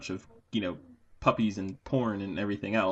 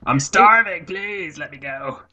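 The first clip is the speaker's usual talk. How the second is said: mock plea; 8 semitones higher, 11 dB louder, slower than usual. Playful plea aside.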